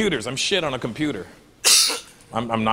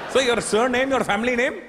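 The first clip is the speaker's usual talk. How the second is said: raised, helpful Indian agent; a high, eager Indian call centre voice; Indian accent; 11 semitones higher, 3 dB louder than usual. Retold or imitated. Agent explains surname to caller in a high Indian accent.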